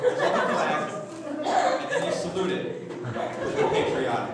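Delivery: loud, airy laughed-out words; punchline half swallowed by laughter; breathless laughing. Real, not acted. Comic to crowd, wheezing laughter through punchline.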